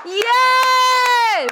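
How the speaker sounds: long, high, very loud cheer; hype shout. Pretend. Co-host cheering the podcast opening, high hype shout. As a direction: whoop it out like a hype man.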